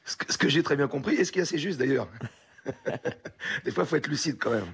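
laughing through the words, voice up high; amused self-mocking laugh. Real, not acted. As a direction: chuckle while admitting it. Singer laughs at own self-mockery.

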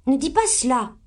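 high-pitched, bossy little reproof; authoritative. A narrator's reading. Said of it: "fit Amy avec autorité". (avec autorité)

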